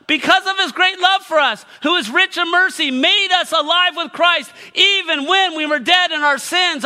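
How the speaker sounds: high, loud preacher's delivery; impassioned preaching. Real, not acted. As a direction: preach at full force, building to a shouted climax. Preaches at full force.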